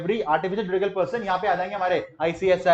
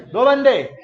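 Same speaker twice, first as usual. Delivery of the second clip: high, loud drill call; punchy, raised teacher's prompt; leading a recitation; 5 semitones higher, 8 dB louder than usual. Shown as a demonstration. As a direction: call it out for the class to follow. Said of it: "मेरे साथ बोलना है".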